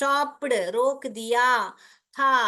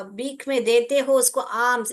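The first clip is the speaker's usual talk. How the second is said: ordinary delivery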